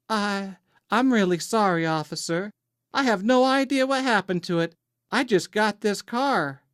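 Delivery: put-on high, innocent Southern accent; apologetic Southern belle. A narrator's reading. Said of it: "slightly affectionate Southern accent".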